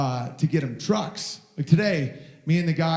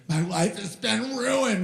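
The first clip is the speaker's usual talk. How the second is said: higher, slow mopey lament; mock miserable Hillary; 6 semitones higher, slower than usual. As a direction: whine it like a sore loser. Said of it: "Hillary Clinton's miserable".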